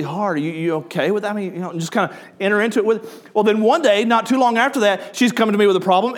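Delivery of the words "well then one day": normal talking voice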